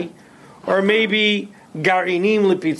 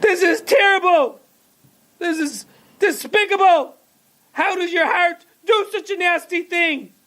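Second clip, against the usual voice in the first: much higher, outraged mother shouting thief; hammed-up shrieking at the storekeeper; high-pitched furious mother yelling at the shopkeeper; mock screaming mother; 11 semitones higher than usual. A narrator's reading. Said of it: "she started to pull her hair".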